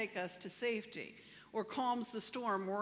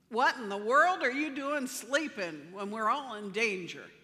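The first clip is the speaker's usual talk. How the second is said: louder, urgent alarmed shout; 9 dB louder than usual. Retold or imitated. Frightened disciples shouting at sleeping Jesus, loud alarm. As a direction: yell it at him like the boat is sinking. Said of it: "They shouted at him".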